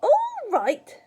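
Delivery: high, loud incredulous retort; yelled. A narrator's reading. Grandma rejecting George's reassurance, yelling. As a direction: screech it back in outrage. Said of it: "she yelled".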